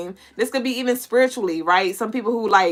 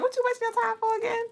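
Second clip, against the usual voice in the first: higher, fast mock scolding; 13 semitones higher, 8 dB quieter, faster than usual. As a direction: tease them like a nagging elder. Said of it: "So that's why I was like".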